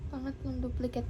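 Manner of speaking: low, soft, deflated murmur; subdued disbelief. Real, not acted. Vlogger accepting a third duplicate, subdued low murmur. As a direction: say it quietly as the shock sinks in. Repeats it after the shock.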